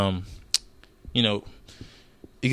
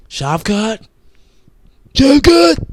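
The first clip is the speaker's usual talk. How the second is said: much higher, loud mocking call of the name; mock fan yell; 12 semitones higher, 10 dB louder, much slower than usual. Vlogger mocking fans who keep asking for Shavkat, high loud yell. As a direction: screech it like a whiny fan.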